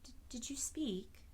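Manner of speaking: awed little whisper; in wonder. A narrator's reading. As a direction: ask in amazed disbelief. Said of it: "asked the girl in wonder".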